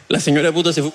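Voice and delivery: ordinary talk, nothing marked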